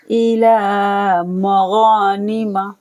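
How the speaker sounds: slow chant stretching the long vowels; Quran recitation. Shown as a demonstration. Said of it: "गॉइन को खींचना है".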